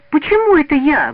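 high, loud indignant protest. A narrator's reading. Лида answers Васёк's accusation, indignant. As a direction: push back, voice leaping up. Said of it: "возмутилась Лида".

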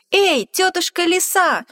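loud, high shout. Acted. Mouse shouting out to the fox. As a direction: call out to the fox. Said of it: "мышонок закричал".

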